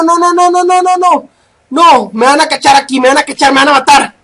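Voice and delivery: normal talking voice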